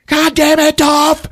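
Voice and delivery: loud, high-pitched scolding; hammed-up angry outburst; mock furious yell. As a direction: yell it like a fed-up girlfriend. Acted quarrel, girlfriend yells.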